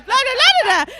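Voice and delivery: very loud high-pitched babble; twin gibberish. Retold or imitated. Drunk friend answering her friend, loud high gibberish. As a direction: babble back like a newborn twin. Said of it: "it was actual literal gibberish".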